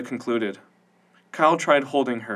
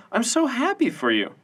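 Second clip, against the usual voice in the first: Veronica's voice; 11 semitones higher than usual. A narrator's reading. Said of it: "said Veronica".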